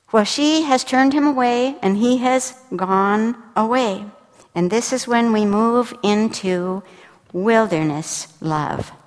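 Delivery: ordinary talk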